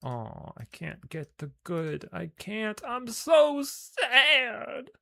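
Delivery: very high, slow, hammed-up whine; mock wailing. Player bemoans the sad story, mock wailing. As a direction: build to a loud, drawn-out fake wail.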